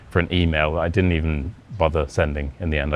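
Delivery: ordinary talk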